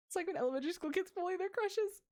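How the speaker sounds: high-pitched gushing aside; giddy, high observation; excited commentary. Real, not acted. Streamer breaks in gushing over game.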